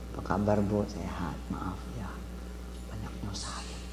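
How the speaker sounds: low, soft son asking forgiveness; low, quiet, humble son; tender apology. Retold or imitated. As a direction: say it gently to mother.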